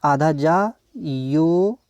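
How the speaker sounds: letter by letter, drawn out; stretched syllables with gaps; spelled out slowly. Shown as a demonstration. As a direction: dictate each piece as you write it. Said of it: "ये कैसे लिखते हैं देखिए".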